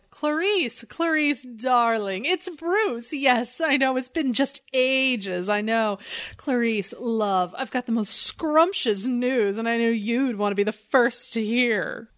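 over-the-top [name]-style socialite gushing; affected foppish Bruce Wayne. Acted. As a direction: lay on the fop, stretching the choice words. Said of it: "it's very foppish".

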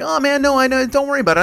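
lightly hammed-up elderly mom reassuring; imitating mom. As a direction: pitch it way up to play your mother brushing it off. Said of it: "she did that thing where she's like".

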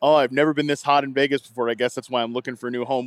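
ordinary delivery

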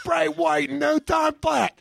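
hammed-up TV infomercial host; infomercial pitchman. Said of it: "I'll do an infomercial".

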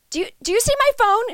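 high, loud, quick call through a door; a frantic high yell to someone outside; yelling from the stall. Retold or imitated. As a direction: shout it from behind the stall. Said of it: "She was yelling it from the stall".

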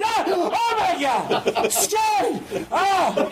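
very high frightened cries; panicked shriek. Retold or imitated. Professor terrified of the lab skeleton, high panicked shrieks. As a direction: shriek at the skeleton to stay put.

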